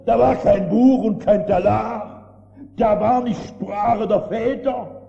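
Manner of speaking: loud, slow climax; preacher at full force against book prayers; full-force preaching. Acted. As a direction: thunder it out, slowly.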